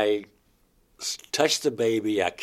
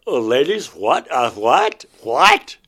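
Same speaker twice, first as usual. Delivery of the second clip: louder and higher with each what, last one very high; shocked disbelief; 4 semitones higher, 11 dB louder, slower than usual. Retold or imitated. Fallen on the floor, shocked at the women, asking what. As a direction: stammer in total shock. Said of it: "I'm like".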